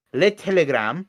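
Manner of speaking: put-on Le Telegram said in a French accent. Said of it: "I don't know why I said in that accent".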